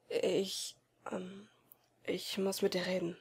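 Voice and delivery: softly, hesitant murmur; quiet murmur. A narrator's reading. Friend about to confess his plans, murmuring. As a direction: mumble it, dreading the news. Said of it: "murmelte er leise".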